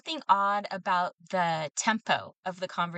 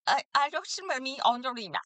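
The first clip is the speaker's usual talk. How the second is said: fast, higher mock Indian call-center accent; imitating Indian agent; 5 semitones higher, faster than usual. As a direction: rattle it off like a hard-to-follow phone agent. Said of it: "and she's like".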